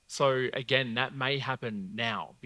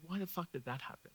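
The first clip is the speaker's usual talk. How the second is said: higher-pitched, softer bewildered question; baffled; 6 semitones higher, 7 dB quieter than usual. Pretend. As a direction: puzzle over it in a hushed, raised voice. Imagined puzzled reaction looking back.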